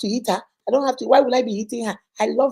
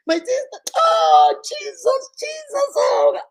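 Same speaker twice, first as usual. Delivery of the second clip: high wailing to Jesus; mild imitated wailing; 13 semitones higher, 5 dB louder, much slower than usual. Brother breaking down over his sister, wailing. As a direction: lightly wail out the name. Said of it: "This man started crying".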